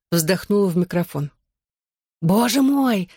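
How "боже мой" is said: high-pitched, thrilled gasp of words; excited exclamation. A narrator's reading. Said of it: "возбуждённо воскликнула подруга". (возбуждённое восклицание, высоким голосом)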